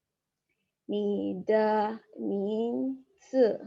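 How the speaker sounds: "你的名字" drawn out very slowly; slow dictation. Shown as a demonstration. Teacher slowly dictating "你的名字" to the class. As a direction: stretch "你的名字" syllable by syllable. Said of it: "How do we write it?".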